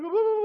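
very high, rising "ah" moan; imitated high wail. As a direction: mimic her wailing as she falls. Imitates woman hitting the ground.

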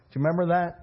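normal talking voice

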